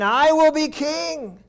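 high, slow proclaiming; boastful prince. Acted. Adonijah proclaiming himself king, boastful. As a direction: puff up like a self-made king. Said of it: "exalteth himself, saying".

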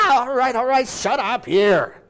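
parent caving in, slowing on shut up here; exasperated parent. Acted. A frazzled parent giving in to the screaming child, exasperated. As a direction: give in to the screaming kid.

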